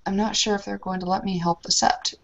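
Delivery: ordinary talk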